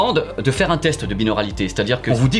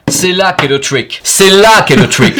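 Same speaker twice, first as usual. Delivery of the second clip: slow, getting louder into a shout; building to a shout; 4 semitones higher, 10 dB louder, slower than usual. Shown as a demonstration. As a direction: hammer it home, louder each time. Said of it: "repeats it louder each time".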